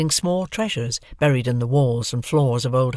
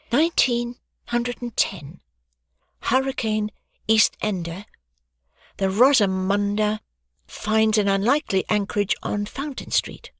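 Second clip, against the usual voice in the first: reading aloud; 7 semitones higher than usual. Acted. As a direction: read the caption out haltingly. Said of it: "Hoggy read on the back".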